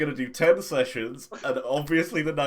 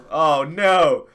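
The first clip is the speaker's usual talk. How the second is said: loud, high-pitched wail of dismay; exasperated, raised-voice lament; 7 semitones higher, 9 dB louder, slower than usual. Real, not acted. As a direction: cry out that it has all gone wrong. Stream breaks mid-show, mock dismay.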